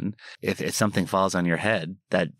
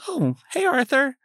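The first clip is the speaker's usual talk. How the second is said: excited greeting. Retold or imitated. Greeting old friend on street.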